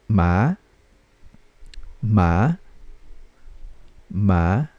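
"Ma" starting low and rising steadily; second tone. Shown as a demonstration. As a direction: lift the pitch of "Ma" upward like a question. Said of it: "paakyat din dapat ang tono".

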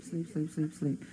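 soft, drawn-out repetition; hypnotic sleep command. Pretend. Hypnotist sending volunteers to sleep, hushed and slow. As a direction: lull them under, slowly.

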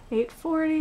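ordinary talk

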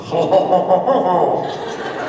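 drawn-out taunting ho-ho-ho; mock jeering laugh. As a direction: jeer at the lone American like a rowdy bar crowd. Bar guys mocking the American.

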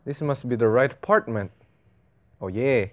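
ordinary talk

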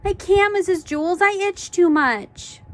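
put-on high, drawn-out childish whine; high-pitched pleading whine; whining. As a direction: whine in protest, stretching the last words. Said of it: "Dana whined".

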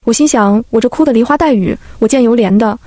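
ordinary delivery

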